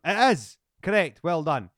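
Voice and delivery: high, loud game-show reveal; triumphant quizmaster. Pretend. Host telling guest the answer is correct, triumphant quizmaster. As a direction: declare the winning answer loudly.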